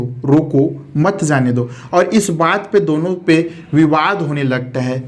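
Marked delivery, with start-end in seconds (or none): 0.0-1.7 "रोको", short break, "मत जाने दो"; pause after रोको. Shown as a demonstration. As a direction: set "रोको" apart, then run "मत जाने दो" as one phrase. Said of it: "सिर्फ इस कॉमा के कारण".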